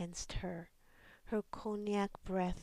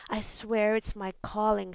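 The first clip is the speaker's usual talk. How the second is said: loud, bitter rant; 8 dB louder than usual. A narrator's reading. Angry caller ranting.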